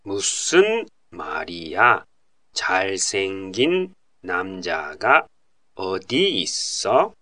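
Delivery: slow, phrase by phrase with pauses; slow version. Shown as a demonstration. Slow dialogue drill for listeners, phrases apart. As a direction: lay out each chunk for learners. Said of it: "ゆっくりバージョンで確認してみましょう".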